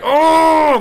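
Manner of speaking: high, loud "Oh" yell; retold yell. Kid yelling through the stitches, a high loud "Oh". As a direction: let out a mock pain yell. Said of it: "like, yelling".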